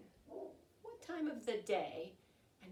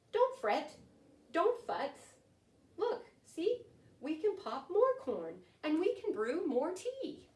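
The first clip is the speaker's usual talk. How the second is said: higher, squeaky little-mouse voice; 7 semitones higher, 6 dB louder, slower than usual. Acted. Mouse cheering up the sobbing bear, higher squeaky voice. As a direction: squeak it out to comfort him. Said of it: "Mouse squeaks".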